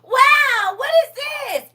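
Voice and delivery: put-on high, loud, amazed child's "wow"; imitating a grandson thrilled by light-up shoes; excited grandson. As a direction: squeal it like a thrilled little boy. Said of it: "He said".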